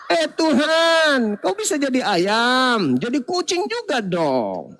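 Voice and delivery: slow, drawn-out plea to God; hammed-up aggrieved cry; mock shouting cat. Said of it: "teriak juga si kucing".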